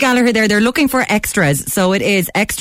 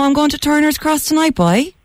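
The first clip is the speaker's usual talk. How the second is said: mock Cork accent; 7 semitones higher than usual. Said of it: "The Cork accent hasn't jumped out".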